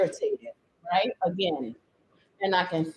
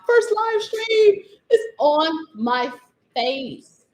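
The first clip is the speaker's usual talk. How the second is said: higher, slow, laughing and crying; real laughing despair; 5 semitones higher, much slower than usual. Real, not acted. Paint on face.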